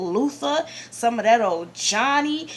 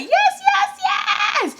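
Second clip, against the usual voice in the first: high squealed cheers, building; tripping out; 4 dB louder than usual. Retold or imitated. Fan at home cheering the Poison routine, tripping out. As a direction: scream it over and over like an ecstatic fan. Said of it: "I was in that bitch tripping".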